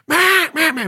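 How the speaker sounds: loud high calling notes; duck call. Shown as a demonstration. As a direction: lean on the first note. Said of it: "stand on that first doe".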